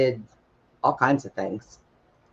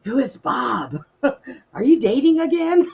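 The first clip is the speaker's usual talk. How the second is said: high put-on voice of a suspicious mother; mock mom voice; 11 semitones higher than usual. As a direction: tease her with a mother's question. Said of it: "She's like".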